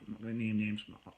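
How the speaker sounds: ordinary talk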